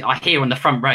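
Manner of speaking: ordinary delivery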